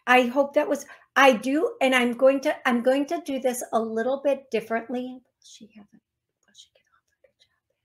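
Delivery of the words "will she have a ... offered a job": whisper. Real, not acted. Asks the spirits privately, aside.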